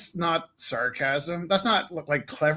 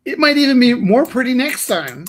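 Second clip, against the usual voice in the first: wide eyed naive; 10 semitones higher, 8 dB louder than usual. Pretend. Mock upbeat encouragement.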